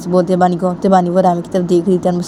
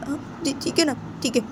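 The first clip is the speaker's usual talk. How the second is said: sulky fade; 7 semitones higher, 12 dB quieter than usual. Acted. Bani gives up, fading.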